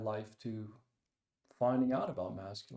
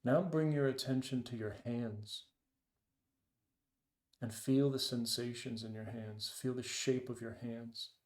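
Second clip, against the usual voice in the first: guided meditation. Acted. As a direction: ease them into the breath, unhurried. Leads a meditation.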